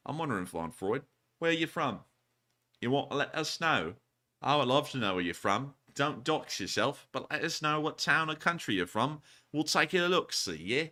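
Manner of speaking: playful put-on British accent; lilting mock-British voice. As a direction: ask it like a chatty Brit. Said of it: "saying that in a British accent".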